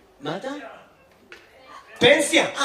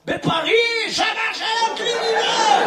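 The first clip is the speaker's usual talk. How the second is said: proud shout; 12 semitones higher, slower than usual. Real, not acted. Audience member shouts proudly.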